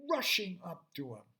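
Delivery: ordinary delivery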